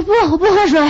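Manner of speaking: high-pitched, delirious mock refusal; feverish babbling. Feverish wife refusing water from husband, high mock delirium. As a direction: play yourself babbling with a fever. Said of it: "我一发烧我全说胡话".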